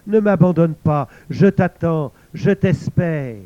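loud pleading cry, slowing at the end; impassioned plea. A narrator's reading. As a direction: beg her not to leave, full voice. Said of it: "pareille au chant du cœur du monde".

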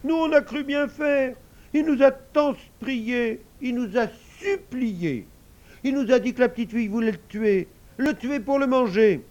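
put-on high, wailing child's plea; sobbing little girl's excuse; tearful. A narrator's reading. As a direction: whine through acted tears, pleading. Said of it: "Les deux petites filles se mirent à pleurer".

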